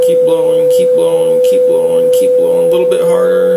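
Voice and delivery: firm, loud encouragement to keep going; loud coaching. Real, not acted. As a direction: push them to blow longer, loud. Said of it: "coaching a breath test".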